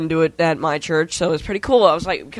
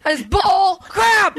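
high, stretched-out indignant holler; 11 semitones higher, much slower than usual. Real, not acted. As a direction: cry it out slowly, half laughing. Still hollering after shouting out window.

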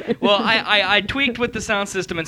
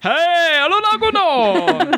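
high, drawn-out mangled German; mock American German; 10 semitones higher, much slower than usual. Host mimics co-host's hotel call, mock American German.

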